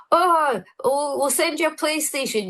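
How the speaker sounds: put-on high, bright kids-TV host sing-song; chirpy fake host reassurance; a presenter covering a blunder with fake cheer; cheesy presenter voice. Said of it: "and I'd go".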